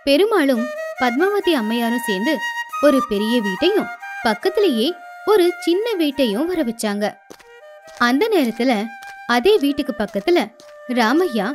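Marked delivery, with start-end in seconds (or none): none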